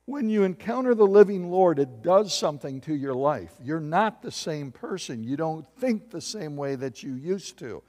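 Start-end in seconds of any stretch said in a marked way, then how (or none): none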